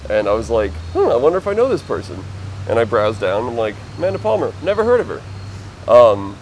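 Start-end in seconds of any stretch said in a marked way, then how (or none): 0.9-2.2 high, quick, louder musing to oneself; curious musing. Retold or imitated. Retelling own thought on browsing an article, curious. 4.0-5.2 high-pitched, louder retold thought; dismissive reaction. Telling own reaction to an unknown name, dismissive.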